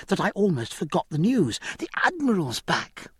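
put-on high, quick, indignant girl; a spoiled young lady taking offence; Cecilia. Cecilia gossips.

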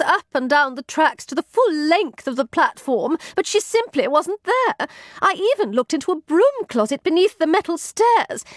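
high, indignant protest; snapped. A narrator's reading. Maid defending her search of the platform, snapping. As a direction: insist testily on your innocence. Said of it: "snapped the other".

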